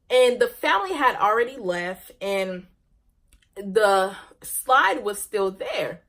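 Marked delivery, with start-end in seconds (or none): none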